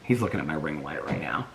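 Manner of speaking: soft low whisper. Real, not acted. Whispering to viewers while a puppy stares at the ring light. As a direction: hush it so the puppy isn't disturbed. Filming near the sleeping puppies.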